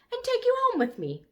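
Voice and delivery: put-on squeaky high, delighted little girl; pleased little girl. A narrator's reading. Lulu telling the brontosaurus she takes him home, delighted. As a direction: triumph in her voice as she claims him.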